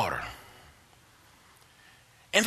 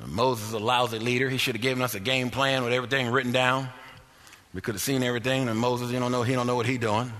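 mock grumbler; 7 semitones lower than usual. Mimicking grumbling people, low voice.

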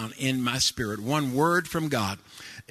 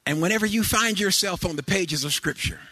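high, loud whooping sermon cadence; preaching; 8 semitones higher, 3 dB louder than usual. Acted. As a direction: build the congregation up with each line. Preaching at full force with organ.

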